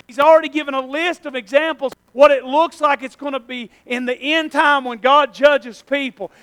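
usual speaking voice

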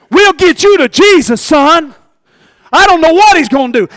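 loud, high preaching build; full-force sermon climax; fiery preaching. Acted. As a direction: preach it hard to the church.

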